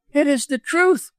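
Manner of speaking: high, emphatic outcry; crying out. A narrator's reading. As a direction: cry it out in a high woman's voice. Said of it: "cried Missus Moxton, finding her voice".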